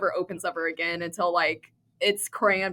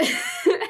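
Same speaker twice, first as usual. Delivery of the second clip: breathy laugh; 11 semitones higher than usual. Real, not acted. Laughing at dad's confession.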